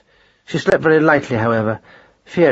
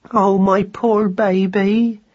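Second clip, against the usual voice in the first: much higher, slow mournful mouse mother; grieving mother mouse; 9 semitones higher, much slower than usual. A narrator's reading. Madeline mourning her lost son, high and slow. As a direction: lament for her lost baby. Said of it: "grieving mother mouse voiced".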